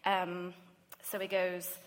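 normal talking voice

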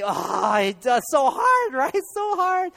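high, loud laughing cry of "so hard"; laughing exclamation. Real, not acted.

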